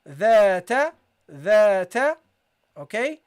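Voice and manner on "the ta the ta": loud, deliberate "the ta"; demonstrated syllables. Shown as a demonstration. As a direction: sound out "the ta" for students, long then short. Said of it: "and then you got ta".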